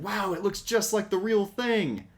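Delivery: a hammed-up, amazed Spike; marveling Spike. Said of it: "Spike marveling at Hound's hologram".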